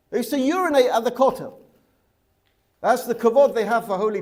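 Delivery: loud, high, emphatic protest; indignant outburst. Real, not acted. Teacher protests the disrespect for holy places, loud and indignant.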